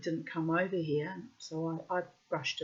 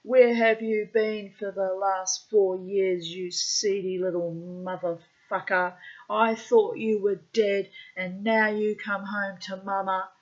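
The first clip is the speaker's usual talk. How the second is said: loud, slow scolding like a jealous wife; much louder, slow indignant dressing-down; 3 semitones higher, 8 dB louder than usual. Retold or imitated. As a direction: tell off the runaway cat like a cheating husband. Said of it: "I sounded like a jealous wife".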